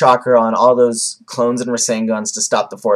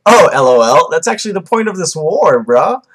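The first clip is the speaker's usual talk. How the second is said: higher, jokey bro-talk; mock-casual, cheeky higher voice; Kabuto; 5 semitones higher, 4 dB louder than usual. Vlogger parodying Kabuto answering Madara, high jokey bro voice. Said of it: "Kabuto's like".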